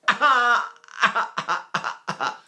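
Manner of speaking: lightly imitated baby ah; baby sounds. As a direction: coo the ah like a small baby. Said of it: "And babies go".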